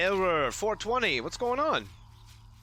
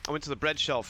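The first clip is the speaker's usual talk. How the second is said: ordinary talk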